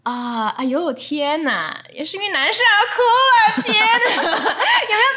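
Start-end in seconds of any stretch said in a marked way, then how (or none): 1.9-5.1 high, loud laughing and crying at once; squealing half-laugh, half-whine. Real, not acted. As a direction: laugh through the embarrassment. Laughing at her own story.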